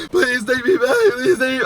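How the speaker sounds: high, frantic put-on begging; hammed-up high-pitched plea; mock pleading. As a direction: imitate a desperate ex. Imitating ex begging again.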